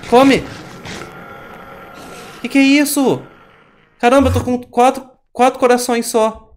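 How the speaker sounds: high, loud frantic yelling; panicked gamer yelling. Real, not acted. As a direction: scream at the Enderman in panic. Fighting the Enderman, panicking.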